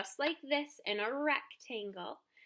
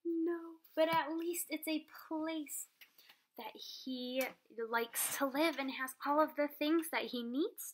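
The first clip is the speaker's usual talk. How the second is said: put-on bright, lively read-aloud for kids; animated children's story narration; storybook reading; 3 semitones higher than usual. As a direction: read it like circle time with the class. Teacher reads story to class.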